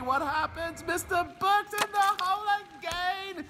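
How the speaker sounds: ecstatic call. Pretend. Announcer ecstatic at another hole in one. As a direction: soar up on the call, slow.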